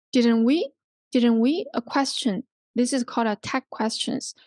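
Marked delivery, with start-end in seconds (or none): 0.1-0.7 "didn't we" with the voice rising on "we"; rising tag question. Shown as a demonstration. Teacher models checking with the listener, rising "didn't we". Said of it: "So a rising intonation". 1.1-1.7 "didn't we" with the voice rising on "we"; rising tag question. Shown as a demonstration. Teacher models checking with the listener, rising "didn't we". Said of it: "So a rising intonation".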